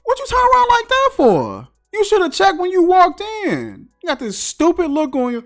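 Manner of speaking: high, far-off incredulous scolding; mocking scold. Mocking Mike for walking in without checking, high and scolding. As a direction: berate him in a high put-on voice. Mocking Mike's careless entry.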